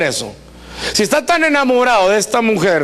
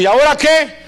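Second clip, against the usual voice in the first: exasperated outburst; 8 semitones higher, 4 dB louder than usual. Retold or imitated. Husband exasperated.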